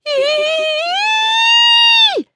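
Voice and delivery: long, very high terrified scream; piercing scream. A narrator's reading. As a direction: scream in horror. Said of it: "screamed Bev".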